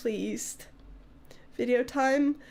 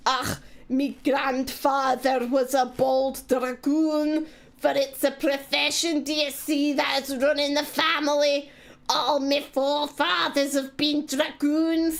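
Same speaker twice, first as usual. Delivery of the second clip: mock Scottish accent. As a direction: read it like a put-on Scottish storyteller. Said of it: "[name]'s come to read the story".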